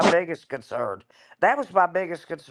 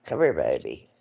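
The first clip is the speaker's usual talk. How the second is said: calling a pet. Real, not acted. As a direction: coax the pet to bed tenderly. Calling her dog to bed.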